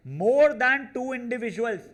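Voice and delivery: high, loud, drawn-out announcement of the phrase; loud emphatic reading. Shown as a demonstration. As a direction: read the key phrase out big, slow and high. Reads key phrase out emphatically.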